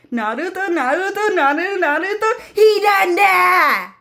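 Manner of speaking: high up-and-down repeats climbing into a long scream; Sasuke's talking pattern. Retold or imitated. Mimicking Sasuke for viewers, high sing-song into scream. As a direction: chant the name high, then wail the ending. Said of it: "talks in that type of pattern".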